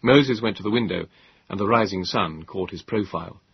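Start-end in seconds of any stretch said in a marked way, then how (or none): none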